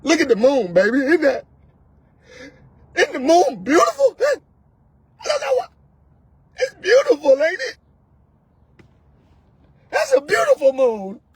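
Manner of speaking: high, quavering tearful awe; mock weepy man. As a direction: cry over the moon like a soap hero. Crying man admiring moon.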